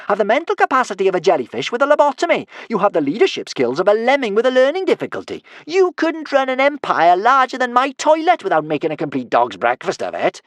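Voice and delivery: high, loud stream of taunts; teasing. A narrator's reading. Kamikaze insulting Norbert mid-fight, high loud teasing. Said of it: "teased Kamikaze".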